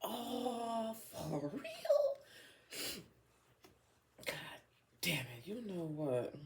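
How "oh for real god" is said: high squeal through laughter; laughing disbelief. Real, not acted. Reader reacting to the card, laughing disbelief. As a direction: squeal it as the laugh takes over.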